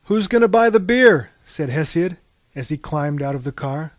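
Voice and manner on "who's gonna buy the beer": raised, high rowdy shout from the car; loud rowdy call. Acted. Hesiod to his buddies, a loud rowdy call. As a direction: call it out to the gang.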